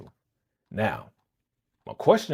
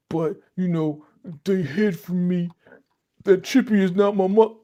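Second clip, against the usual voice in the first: higher-pitched imitation of his excuses; mock protest; 8 semitones higher, 5 dB louder than usual. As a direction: play him pleading his case, pitched up. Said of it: "She was going in on your boy, and he was like".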